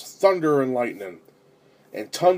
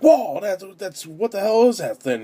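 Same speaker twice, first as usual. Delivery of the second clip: startled reaction, higher pitched; mock startled outburst, pitched up; retold shock; 6 semitones higher than usual. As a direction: recount being jolted in your seat. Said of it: "I got shocked".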